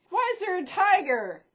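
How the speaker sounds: ordinary delivery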